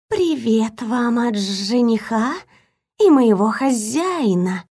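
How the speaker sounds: slow, hissing serpent messenger; snake voice. A narrator's reading. Talking snake delivers greeting. (медленный шипящий голос змеи)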